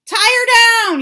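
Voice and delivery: loud high command; angry yell. A narrator's reading. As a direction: bellow the order. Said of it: "he yelled".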